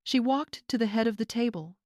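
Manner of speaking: ordinary delivery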